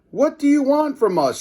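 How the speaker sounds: high, loud frantic reporter shout. A narrator's reading. Reporter shouting up at the giant robot. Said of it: "shouted reporter Ashley".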